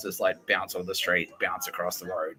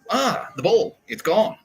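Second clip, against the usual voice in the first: high, loud cry of loss; mock dismay; 11 semitones higher, 6 dB louder, slower than usual. Jerks watching their soccer ball vanish, mock dismay. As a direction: wail lightly as if imitating the losers.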